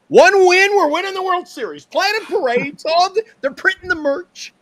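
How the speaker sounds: high, loud, giddy imitation of overexcited fans; mock fan hype. Host mocking Mets chat fans after one win, mock excited. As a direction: ham up the chat's premature celebration. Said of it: "This is what it's like when I sit here".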